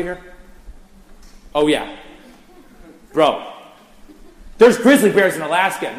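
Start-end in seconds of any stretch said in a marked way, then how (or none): 4.6-6.0 louder and higher, driving it home; emphatic declaration. Real, not acted. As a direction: hammer the point home to the crowd. Emphatic punchline to crowd.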